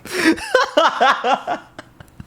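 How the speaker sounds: a long, high, helpless real laugh. Real, not acted. Vlogger losing it over his towel joke, long high laugh.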